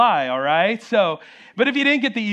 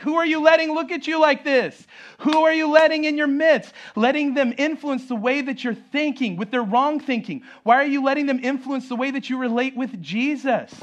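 high-pitched, incredulous questioning as Paul; indignant challenge; 6 semitones higher than usual. Acted. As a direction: act out an apostle taking his readers to task. Voicing Paul challenging the Galatians.

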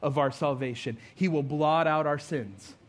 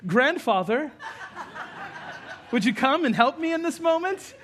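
high, hammed-up formal plea; mock-proper child calling on grandfather; absurdly polite request; 10 semitones higher than usual. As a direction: climb high into an over-polite question. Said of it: "It's not what I did at all".

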